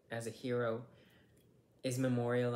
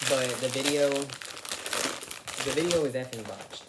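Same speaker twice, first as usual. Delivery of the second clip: louder, slow and exasperated; exasperated outburst; 9 dB louder, slower than usual. Real, not acted. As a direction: vent about the botched video. Exasperated about the ruined video.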